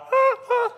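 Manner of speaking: high short "ah, ah" of alarm; comic scrambling yelps; mock panicked yelps. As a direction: yelp as if dodging tacklers. Acts out scrambling from tacklers.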